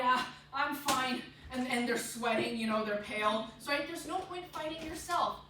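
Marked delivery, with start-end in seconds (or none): 0.0-1.2 slow, loud insistence on being okay; imitating someone hiding their nerves; mock denial of fear. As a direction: act out unconvincing bravado, drawn out. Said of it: "try to fight the fact that they're scared".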